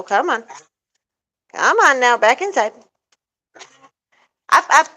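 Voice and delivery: loud, firm herding voice; shooing them in. Real, not acted. Owner herding her animals back into the coop, loud and firm. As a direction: chivvy the animals back inside.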